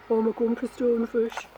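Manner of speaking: a lightly voiced grumpy fish; grumpy stone fish. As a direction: grumble it like a sulky stone fish. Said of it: "stone fish always look very grumpy".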